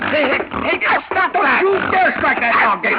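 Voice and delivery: put-on high, loud furious old man yelling; angry shout. Invalid uncle to nephew over the dog, furious high shout. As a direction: scream at him to stop.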